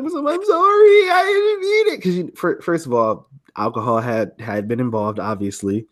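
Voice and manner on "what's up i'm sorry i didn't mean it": mock tearful wail, voice high; crying apology. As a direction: imitate her crying out the apology. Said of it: "tears running down her face".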